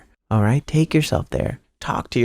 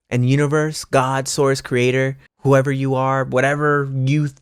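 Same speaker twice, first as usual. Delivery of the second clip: usual speaking voice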